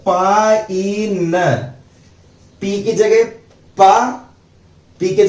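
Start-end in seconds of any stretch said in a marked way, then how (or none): 0.1-1.3 pine drawn out very slowly; slow demo word. Shown as a demonstration. Teacher to students, very slow pine. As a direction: stretch pine long and clear. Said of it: "किसकी?".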